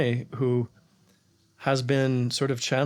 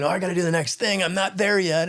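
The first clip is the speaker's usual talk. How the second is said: hurried inner worry; 7 semitones higher than usual. Acted. Voicing a hurried anxious thought.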